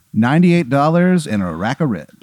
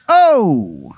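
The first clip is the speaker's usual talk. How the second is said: surprised; 6 semitones higher, 5 dB louder than usual. Acted. Mobster's surprised exclamation.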